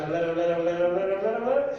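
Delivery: mock nonstop chatter; endless jabbering. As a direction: rattle it on, winding up to a peak. Mimics mother's endless talking.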